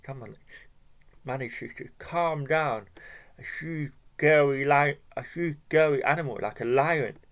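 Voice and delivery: ordinary delivery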